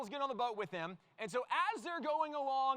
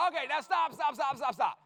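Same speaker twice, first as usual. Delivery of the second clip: raised voice, repeating stop to cut through the noise; loud, firm halt over the crowd noise; calling out stop; 3 semitones higher, 6 dB louder than usual. Real, not acted. Pastor calling the volunteers to stop the storm noise, loud and firm.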